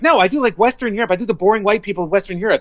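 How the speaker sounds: high-pitched, insistent retold objection; flustered protest. Actor to agent about the accent request, flustered protest.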